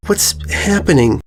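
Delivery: high, small filly voice calling her sister; a little girl asking timidly; young filly voice. A narrator's reading. Filly calling her sister, high and small.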